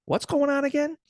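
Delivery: high, loud baffled question; confused exclaim. Retold or imitated. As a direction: sound totally lost. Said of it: "it's like".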